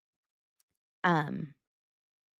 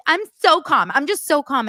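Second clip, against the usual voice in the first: loud, high, frantic imitation; mock-calm insistence; 7 semitones higher, 6 dB louder than usual. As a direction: protest your calm far too hard. Mocks Christine claiming calm again.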